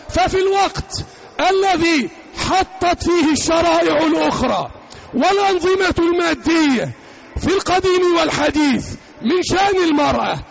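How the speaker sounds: usual speaking voice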